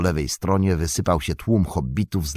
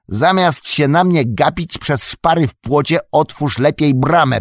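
loud, high, impatient command; impatient order; 9 semitones higher, 7 dB louder than usual. A narrator's reading. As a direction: snap the order through the fence. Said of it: "powiedział Mary". (Merry niecierpliwie każe otworzyć bramę)

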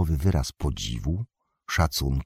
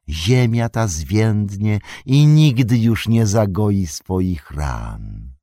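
louder, higher, slow ominous threat; menacing wizard curse; 4 semitones higher, 5 dB louder than usual. Acted. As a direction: lay a curse on the land.

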